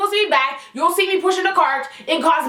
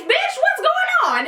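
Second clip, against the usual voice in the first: mock incredulous squeal; 11 semitones higher than usual. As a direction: jump way up high in mock disbelief. Mocking customer in retold quote.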